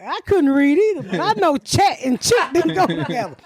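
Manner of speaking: laughing at the brother's misreading; laughing retort. Retold or imitated. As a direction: crack up mid-line. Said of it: "I said".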